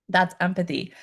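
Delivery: ordinary talk, nothing marked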